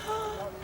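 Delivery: sudden high gasp of mock shock; a hammed-up sailor's gasp; dramatic gasp. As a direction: gasp as if struck by bad news. Sailor gasps at hard times.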